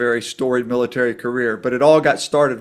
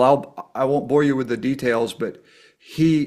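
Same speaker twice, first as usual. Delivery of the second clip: ordinary talk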